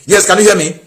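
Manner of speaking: high, loud checking call. Real, not acted. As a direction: call out to a caller who may not hear. Calling to caller on bad line.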